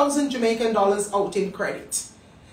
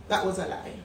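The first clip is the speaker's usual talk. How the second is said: normal talking voice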